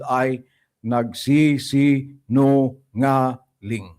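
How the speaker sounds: very slow, each syllable set apart. Real, not acted. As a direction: break the accusing word into separate, deliberate syllables. Spells out his accusation by syllables.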